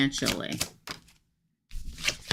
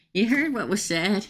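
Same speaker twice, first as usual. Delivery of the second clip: ordinary delivery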